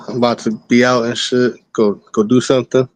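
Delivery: ordinary delivery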